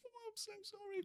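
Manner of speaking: very high, soft mortified squeak; cringing apology; strangled sorry; squeaky apology. Retold or imitated. Apologising again.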